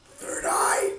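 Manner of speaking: breathless, whispered gasp of awe; stunned gasp. Retold or imitated. Fan re-living the third eye reveal, gasping. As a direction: gasp it like you can't believe it.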